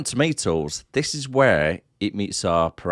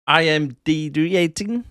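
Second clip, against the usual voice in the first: slow South African drawl on the letters; South African accent; 5 semitones higher, slower than usual. Retold or imitated. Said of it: "Was that your South African accent?".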